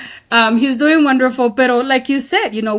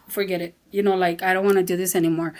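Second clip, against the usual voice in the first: fed-up mumble; 5 semitones lower, 6 dB quieter, faster than usual. Retold or imitated. Kids fed up with school, mumbling softly. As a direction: drop it to a soft, quick fed-up mutter.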